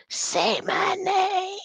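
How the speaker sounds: high, slow ghostly call; mock spooky summons; eerie conjuring. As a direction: drag it out like summoning a spirit. Mocking, conjuring voice.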